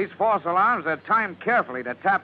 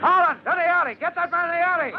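shouting across; 12 semitones higher than usual. Acted. Lieutenant yells to Collins to grab the man, high shout. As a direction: yell the order to catch him.

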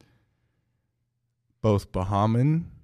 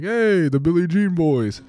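high, loud, slow greeting; imitating excited greeter; 8 semitones higher, 5 dB louder, slower than usual. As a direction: call it out like an eager fan. Imitating guy greeting them.

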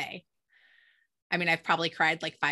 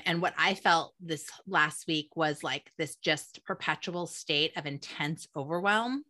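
ordinary talk, nothing marked

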